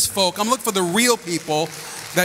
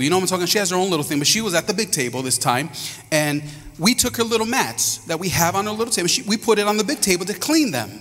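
ordinary talk

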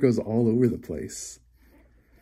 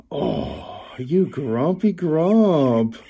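slow teasing; 3 semitones higher, much slower than usual. Acted. Teasing grumpy cat while brushing.

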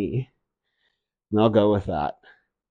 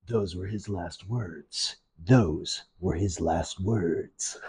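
low, slow, soft doom-laden narrator; mock narrator voice; 6 semitones lower, 10 dB quieter, slower than usual. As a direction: intone it like a grim documentary voice. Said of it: "that narrator ... when he said".

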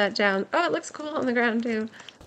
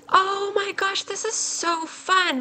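high, drawn-out delight; thrilled; 8 semitones higher, slower than usual. Real, not acted. Player thrilled by a new party item, high gushing. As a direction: gush slowly in amazed excitement.

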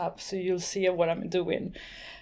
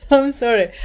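laughing; 3 semitones higher, 5 dB louder than usual. Real, not acted. Laughs after calling name horrible.

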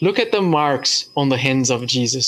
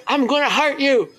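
mock threat; 12 semitones higher than usual. Prisoner threatens the priest.

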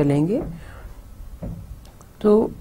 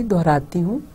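normal talking voice

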